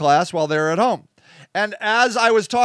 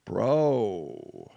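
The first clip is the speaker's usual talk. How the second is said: low, soft, dragged-out "bro" over and over; exasperated bro; 10 semitones lower, 9 dB quieter, slower than usual. Retold or imitated. Talking to himself about his own reaction, lower and quieter, drawn-out exasperated bro. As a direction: sigh the word "bro" like a weary friend. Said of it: "I'm like".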